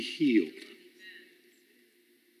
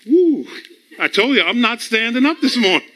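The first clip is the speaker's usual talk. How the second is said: higher, lightly acted defiant body; balky body's voice; 7 semitones higher than usual. The preacher's body refusing to get up, mock defiant. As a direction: play a body stubbornly refusing, pitched up.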